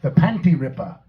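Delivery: attempted Belizean accent. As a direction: lightly try a Belizean accent. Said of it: "trying to do a Belizean accent".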